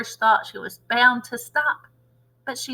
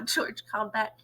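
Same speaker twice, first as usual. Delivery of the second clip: ordinary delivery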